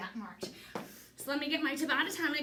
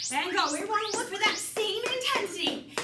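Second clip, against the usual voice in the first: aerobics instructor; 7 semitones higher than usual. Acted. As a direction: push the class hard. Coach drives high knees round.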